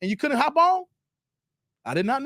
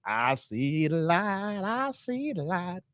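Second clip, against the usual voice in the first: slow, low, hushed revelation; quoting House Party; 4 semitones lower, 7 dB quieter, much slower than usual. Retold or imitated. Streamer reenacting the House Party scene, slow and hushed. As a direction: let the words drag out softly. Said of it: "that scene from House Party".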